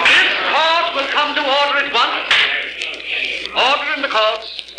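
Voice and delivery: bang the gavel with your voice; calling to order. Acted. Judge calls court to order.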